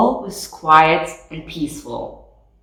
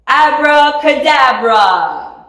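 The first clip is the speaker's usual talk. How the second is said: higher, louder, slow magic word; shouted spell; 7 semitones higher, 5 dB louder, slower than usual. A narrator's reading. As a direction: Winnie casts the rocket spell, shouted slowly. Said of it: "and shouted".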